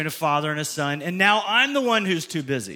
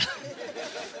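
soft breathy laugh; 12 dB quieter than usual. Real, not acted. Laughs at his own joke.

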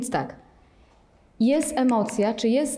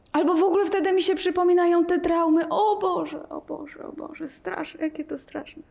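high and fast, then dropping to a whisper; mock horror; 7 semitones higher than usual. Teacher parodies recalled trauma, mock whispered horror. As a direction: panic that sinks into hushed dread. Teacher voicing panicked meditator.

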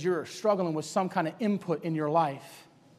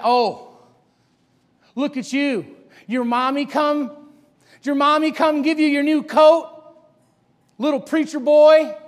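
loud, high taunting; mocking; 7 semitones higher, 9 dB louder, slower than usual. Hophni and Phinehas making fun of Samuel, mocking taunt. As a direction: tease him like a bully. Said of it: "Making fun of his relationship with his family".

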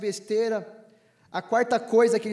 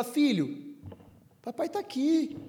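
put-on soft, tender, comforting a small child; reassuring father; 3 semitones higher, 4 dB quieter than usual. Pastor voicing a father comforting his son, softly reassuring. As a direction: lean in and reassure him gently. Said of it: "e fala".

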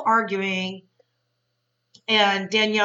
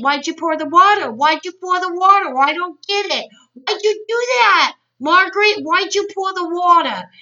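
loud, high mock yelling; Teresa, frantic demanding; 8 semitones higher, 6 dB louder than usual. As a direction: imitate a baffled friend repeatedly demanding answers. Imitates Teresa demanding an explanation.

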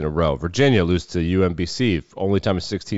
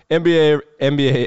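excited shout; 8 semitones higher, 6 dB louder than usual. Real, not acted. Hyping the NBA schedule.

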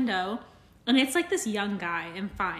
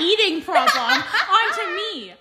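laughing; 7 semitones higher, 7 dB louder than usual. Real, not acted.

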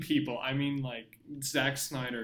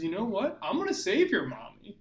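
higher, hammed-up declaration; mock heroic resolve; 6 semitones higher, 3 dB louder than usual. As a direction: play the hero's big decision as a joke. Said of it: "he decides".

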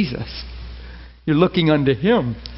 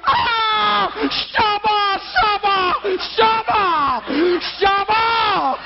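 mock tongues shouting; much slower than usual. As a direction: ham up a panicked deliverance. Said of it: "Do you see what we do?".

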